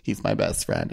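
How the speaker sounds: low, soft, half-breathed deadpan; moody goth girl. Retold or imitated. Goth girl introduces her tarantula in a low near-whisper. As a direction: drop low and almost whisper it. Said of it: "She'd be like".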